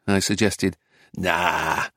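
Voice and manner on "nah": low, growled dismissal; growling. A narrator's reading. Driver rejects the narrator's suggestion, low growl. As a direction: growl it lower and a touch quieter. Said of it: "growled Donkey Donk".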